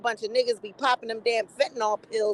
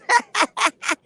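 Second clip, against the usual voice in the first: real amused cackle at own joke; hearty laugh; 6 semitones higher than usual. Real, not acted. Woman roasting a man online, gleeful laughter.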